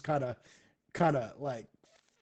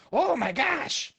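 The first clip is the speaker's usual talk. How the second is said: frantic exclaim; 11 semitones higher, 4 dB louder, slower than usual. Retold or imitated. Alienist frantic over stuck coin.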